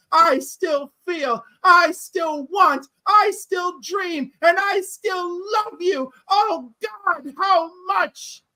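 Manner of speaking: impassioned movie monologue, drawn out, rising in pitch; famous film speech. Acted. Host performing a famous film speech for the panel, impassioned and building. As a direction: deliver it as a slow, building dramatic confession. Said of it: "Cliff doing famous speeches from films".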